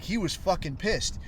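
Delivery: ordinary talk, nothing marked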